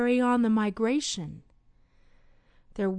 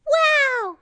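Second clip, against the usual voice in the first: very high, louder squeal of wow; excited exclaim; 6 dB louder than usual. Acted. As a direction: squeal with delight. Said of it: "she exclaimed".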